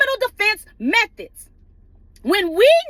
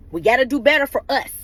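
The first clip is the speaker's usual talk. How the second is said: normal talking voice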